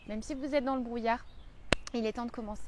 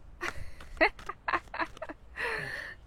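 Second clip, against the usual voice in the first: breathy giggle; 8 semitones higher than usual. Real, not acted. Laughing at her accent.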